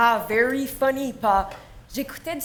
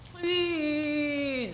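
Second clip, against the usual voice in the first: pleading; 6 dB quieter than usual. Acted. Teen begging father to go dancing.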